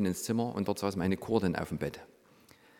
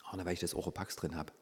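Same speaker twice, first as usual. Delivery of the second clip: soft, small voice, playing sheepish; meek quiet answer; 3 semitones lower, 8 dB quieter than usual. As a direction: answer softly like a caught child. Meek replies to the Holy Spirit.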